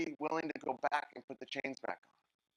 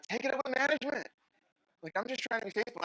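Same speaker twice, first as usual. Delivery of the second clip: exasperated Moses; 8 semitones higher, faster than usual. Pretend. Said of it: "I'm like".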